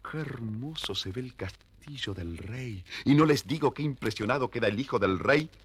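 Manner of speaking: usual speaking voice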